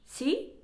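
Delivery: し？ rising at the end like a native; し？ said with a Spanish lift; Spanish-sounding sí. Shown as a demonstration. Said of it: "今、うまく言えました".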